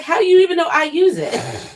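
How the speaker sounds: high, loud indignant comeback; incredulous retort. Retold or imitated. Replying to people who say you use it, incredulous. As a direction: fire back in loud, high-pitched disbelief.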